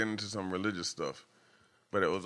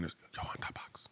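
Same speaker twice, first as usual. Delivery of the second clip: hushed whisper imitating mom; very soft, voiceless mock menace; whispered threat; 13 dB quieter than usual. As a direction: whisper it as a secret warning. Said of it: "she'll whisper it to me".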